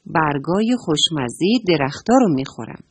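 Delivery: usual speaking voice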